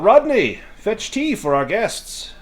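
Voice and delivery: hearty call across the house. Acted. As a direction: summon the butler from the next room. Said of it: "calls out".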